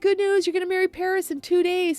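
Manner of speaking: high, bright announcing voice; cheery dad. Retold or imitated. Father cheerfully telling Juliet she'll marry Paris, high voice. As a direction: brightly break the news.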